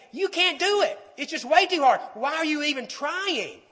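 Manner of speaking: high, loud discouraging taunt; voice of the liar. Acted. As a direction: push the pitch up, urge them to quit. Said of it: "The voice of the liar is saying".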